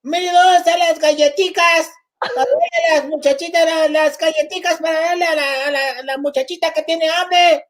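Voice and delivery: high-pitched, singsong Latin American Spanish dialect; regional Spanish dialect. Acted. Girl Scout parent calling Millie for cookies, high exaggerated Spanish dialect. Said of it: "my mother will assimilate your dialect".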